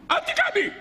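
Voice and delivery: very high-pitched squealed protest; mock cry. Trees cry out to the approaching snake, shrill mock cry. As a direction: squeal it as a tree crying out. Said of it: "העצים צעקו".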